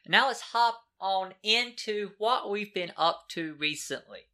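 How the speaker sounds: stiff robot-like delivery; robotic. Real, not acted. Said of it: "I don't know why I said it so robotic I felt".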